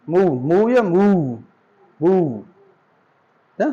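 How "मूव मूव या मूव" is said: slow, stretched long oo in मूव; drawn-out move. Shown as a demonstration. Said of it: "और यहाँ पे क्या sound दे रहा है?". (मूव को धीमे खींचकर)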